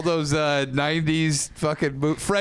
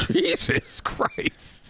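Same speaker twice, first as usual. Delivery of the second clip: laughing whisper; 11 semitones higher, much slower than usual. Real, not acted. Reacting to explicit reading.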